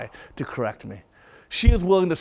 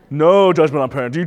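laughing through the words; 3 semitones lower, 5 dB louder than usual. Real, not acted. Preacher laughing at his parenting joke, said laughing. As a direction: chuckle as you say it.

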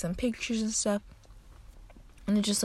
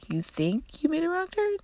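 high, disbelieving echo of her words; incredulous echo; 9 semitones higher than usual. Retold or imitated. Granddaughter to lost grandma, echoing in disbelief.

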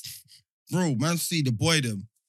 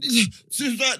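normal talking voice

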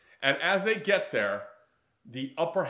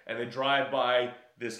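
ordinary delivery

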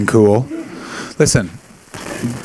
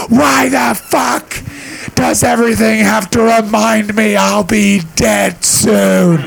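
much higher, very slow wailing yell; mom's despairing rant; 8 semitones higher, slower than usual. Retold or imitated. Mom ranting once the Wi-Fi works, slow wailing yell. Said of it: "she went".

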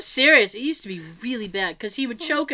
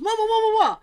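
whiny mock baby wail, higher; mock crying; 9 semitones higher, 3 dB louder, much faster than usual. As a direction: imitate a grown man whining like a baby. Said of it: "he goes".

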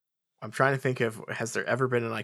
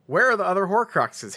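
loud demanding question; 7 semitones higher, 5 dB louder than usual. Acted. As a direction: demand an answer through teeth. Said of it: "Harry demanded, teeth gritted".

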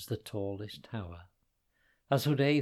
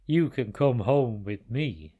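giant voice; 6 dB louder than usual. Acted. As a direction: speak as the big giant. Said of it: "he said".

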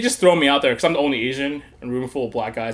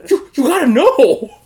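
loud, high, laughing plea for answers; exasperated pleading; 13 semitones higher, 7 dB louder than usual. Retold or imitated. To his cellmate, begging to know who the murderers are, high and loud. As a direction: press him, half laughing, high and loud.

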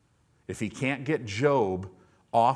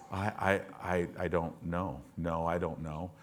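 soft, low, stammering I don't know; a cowed man mumbling his answer; meek halting Job, much softer; timid stammer; 6 semitones lower, 9 dB quieter than usual. Retold or imitated. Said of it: "God starts talking to him".

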